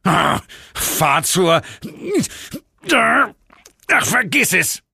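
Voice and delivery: slow, then high, fed-up demon voice; a devil giving up in disgust; exasperated devil. Acted. (entnervte Teufelsstimme)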